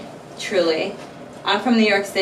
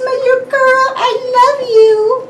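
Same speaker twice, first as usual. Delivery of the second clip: much higher, louder cooing pet voice with a whimper; 5 dB louder than usual. Real, not acted. Owner gushing to her cat Coral, high whimpering baby talk.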